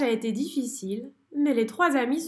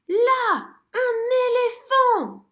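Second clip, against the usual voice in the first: cri très aigu et fort, lent; s'émerveiller en criant; exclamation émerveillée; 12 semitones higher, 7 dB louder, slower than usual. A narrator's reading. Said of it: "s'exclame Zigomar". (merle émerveillé qui crie)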